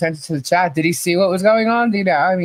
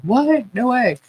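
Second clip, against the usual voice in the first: pitched-up retold What, no way; shocked disbelief; 6 semitones higher, slower than usual. Husband retelling his shock at the gift boxes, higher and amazed. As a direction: imitate his stunned reaction.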